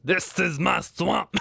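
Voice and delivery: slow, drawn-out ogre growl; Shrek impression. Streamer quoting Shrek to chat, slow ogre voice. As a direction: play Shrek claiming his turf.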